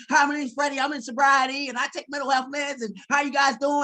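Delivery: imitating his overexcited first day; all happy. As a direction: burst with happiness meeting everyone. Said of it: "And I'm just all happy".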